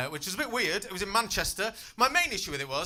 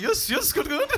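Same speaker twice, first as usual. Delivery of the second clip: much higher, nervous little replies; meek reply; 12 semitones higher, 4 dB louder than usual. Retold or imitated. Scared teaching assistant answering the kids, much higher meek voice. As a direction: squeak out agreement, too scared to correct. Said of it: "I'd be like".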